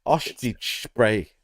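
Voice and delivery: "Ostrich Spray" slurred slowly with sh sounds, "oshtish spray"; drawn-out mispronunciation. Retold or imitated. Host savours the wrestler's silly name, "Ostrich Spray" slurred slowly. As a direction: stretch "Ostrich Spray" and mush the s into sh. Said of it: "just the way it sounds".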